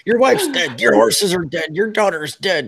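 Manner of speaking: high, loud, escalating rant; mock outrage. Real, not acted. Fan ranting about Stannis's losses, high and loud.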